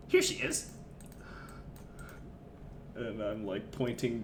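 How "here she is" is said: goblin voice. Acted. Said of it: "Goblin voices".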